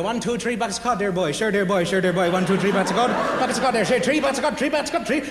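Newfoundland chant, with "three" as "tree"; Newfie accent. Shown as a demonstration. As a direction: rattle it off as a comic Newfie. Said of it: "If we want to go from here to Newfie".

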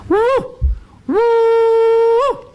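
high loud whooping call; bunyip mating call. Retold or imitated. Battered hunter retells calling at the cave, loud high whoops. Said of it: "I remembered the mating call".

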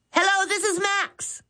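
Hello blurted out high and eager. Acted. Max answers the phone hoping for a customer, eager blurt. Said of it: "he blurted eagerly".

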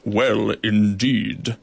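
low, very slow, gruff; gruffly. Acted. Goliath answering the beetle, gruff and slow. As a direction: rumble it gruffly. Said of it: "said Goliath gruffly".

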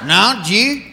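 "Jeet", did you squashed into one syllable; Southern slurred speech. Acted. Southerner answering about lunch, words slurred together. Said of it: "you hear somebody say".

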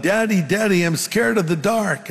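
mildly acted yell of a frightened boy; scared little boy calling out. Little boy scared at bedtime calls his father, calling out. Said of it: "he yelled out".

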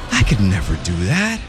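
ordinary delivery

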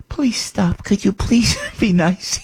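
high, mock-pleading plea with a laugh; hammed-up plea to be nice. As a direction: beg them sweetly to stop. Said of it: "I just get like".

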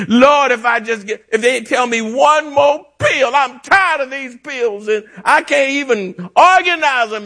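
high-pitched plea to the Lord; exasperated complaint. Acted. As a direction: voice a fed-up patient, pitched right up. Voices a weary patient's complaint.

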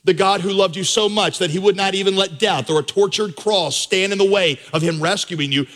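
ordinary talk, nothing marked

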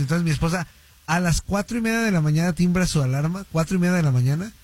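ordinary talk, nothing marked

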